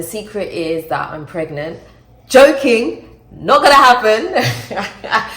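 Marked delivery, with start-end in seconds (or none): 2.3-5.3 high, loud, breaking into a laugh; laughing retraction. Real, not acted. Taking back a pregnancy joke to viewers, high laughing denial. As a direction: call off the joke loudly, laughing.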